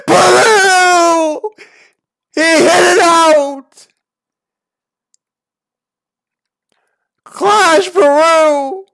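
screaming high home run call, voice breaking; voice-cracking home run call. Acted. Gamer calling the go-ahead homer, voice cracking. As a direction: scream it like a walk-off announcer. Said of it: "My voice just cracked during that call".

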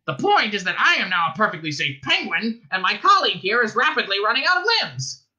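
high, indignant penguin rant; raging. A narrator's reading. Ford as penguin arguing with voice, raging. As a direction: rant with mounting, high-pitched fury. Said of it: "raged Ford".

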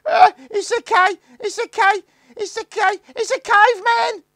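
very high, loud terrified shriek; small boy shrieking in terror; sobbing scream. A narrator's reading. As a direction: scream and sob as you run. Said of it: "sobbing and screaming".

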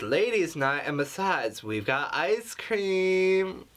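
squealing mock girlfriend; mock girly friend voice. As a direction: parody a gushing girlfriend texting. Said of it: "is this really how women talk over the phone".

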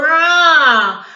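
monster roar. Acted.

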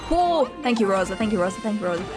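ordinary talk, nothing marked

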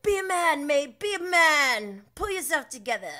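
high, loud jeering yell; loud taunting impression of James; mock shout. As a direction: bellow at him to man up. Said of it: "It's like".

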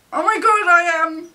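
loud, high shriek of disbelief; thrilled shock. Real, not acted. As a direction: cry out at a wished-for surprise. Discovers the wished-for photo card.

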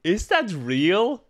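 high, loud, slow disbelief; stunned, can't believe it's real; surprised. Real, not acted. Shocked by the revolver mousetrap.

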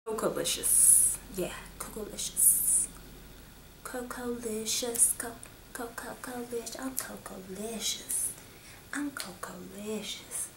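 breathy playful whisper of the shade name; whispered name game. Pretend. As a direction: savour the word in a hush.

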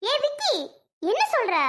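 high, startled protest at a threat; a mother aghast at what she just heard; shocked cry. Acted. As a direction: gasp out his name in disbelief.

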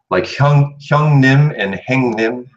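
행님 said the Busan way, 형님 the standard way; Busan pronunciation. Shown as a demonstration. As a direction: contrast 형님 with Busan 행님. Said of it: "different word usage and pronunciation".

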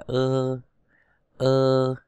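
long, level Ơ vowel; E read as Ơ. Shown as a demonstration. Teacher shows learners how E is read, a drawn-out Ơ. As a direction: hold Ơ steadily for the letter E. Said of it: "cái chữ E đọc như Ơ".

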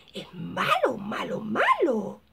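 slow, drawn out villain growl on malo; menacing bad guy. Fan describing villain roles, slow menacing drawl. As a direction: savor the word like a villain.

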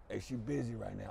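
low, quiet brush-off bouncer line. Retold or imitated. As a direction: drop low and soft, dismissive. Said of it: "He's like".

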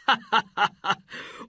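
high amused burst of laughter; royal laugh. Acted. Sultan laughing at a poor boy's proposal, high and amused. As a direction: laugh at the absurd request. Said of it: "The Sultan laughed".

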